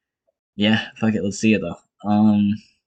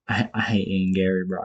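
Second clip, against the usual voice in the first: usual speaking voice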